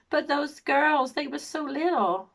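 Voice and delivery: high, slow, exaggerated American imitation; drawn-out mock American whine; terrible American accent. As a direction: put on a bad, loud, high-pitched American accent. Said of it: "Sorry about my terrible American accent".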